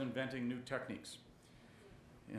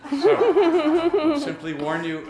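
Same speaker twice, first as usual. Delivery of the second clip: breaks into a laugh mid-warning; amused, laughing aside; 15 dB louder, much slower than usual. Real, not acted. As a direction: laugh through the joking warning. Chair jokes about strict timing.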